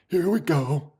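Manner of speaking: soft, contained self-talk; quiet composure. Real, not acted. Streamer settling down after cheering, quiet self-talk. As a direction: say it gently, holding the excitement in.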